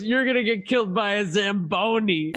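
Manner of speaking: high-pitched movie taunt; gleeful mock taunt. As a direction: taunt them, about to crack up. Quotes Deadpool's taunt, laughing after.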